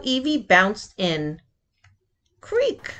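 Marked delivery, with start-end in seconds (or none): 2.4-2.9 high creak; a high creaky bed noise. A narrator's reading. Bed sound effect in story.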